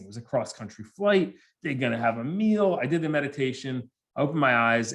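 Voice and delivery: ordinary delivery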